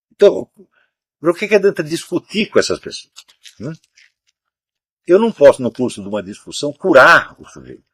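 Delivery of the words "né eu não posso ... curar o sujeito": normal talking voice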